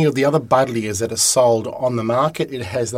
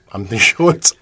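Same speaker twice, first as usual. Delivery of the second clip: normal talking voice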